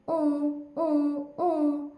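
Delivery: muffled whimpering "oo oo" from inside a pot; muffled boy's wail. Acted. Boy keeps wailing inside the pot, muffled. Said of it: "रोने की आवाज़ मुसलसल आ रही है".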